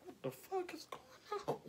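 high, hushed, laughing disbelief; shocked disbelief. Retold or imitated. Teller to the audience, recalling the client praying over her face, stunned hushed squeak.

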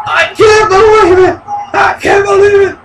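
high-pitched cry of disbelief; excited fan in shock; disbelieving shout. Real, not acted. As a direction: shout it, astounded by a near-fall. Stunned by the kick-out.

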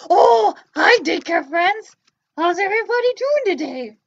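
a bouncy little puppet popping up. Pretend. Puppet greets daycare friends.

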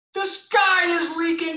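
very high shouted peep; panicked peep. Acted. Reading aloud, the smallest chicken panics at the raindrop. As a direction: shriek the alarm again. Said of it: "she peeped".

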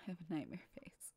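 rushed whisper to herself; fast whispered self-mockery. Real, not acted. Self-critical in mirror.

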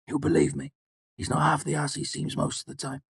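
a jittery fighter counting under his breath; nervous soldier voice. A narrator's reading. Martin voiced by narrator.

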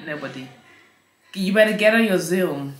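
ordinary delivery

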